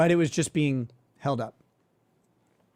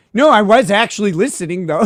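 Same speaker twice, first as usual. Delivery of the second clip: mocking the lawyer; 5 semitones higher, 9 dB louder than usual. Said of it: "And then he says".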